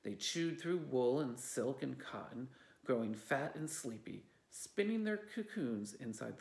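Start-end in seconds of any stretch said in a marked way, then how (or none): none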